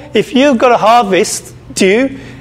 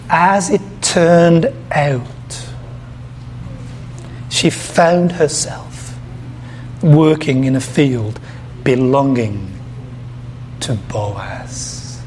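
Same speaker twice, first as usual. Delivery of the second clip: slow, low and dropping softer toward the end; slow emphatic reading; 6 semitones lower, 6 dB quieter, slower than usual. Shown as a demonstration. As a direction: lean into each phrase, letting the name Boaz sink almost to nothing. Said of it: "in my, uh, foreign accent".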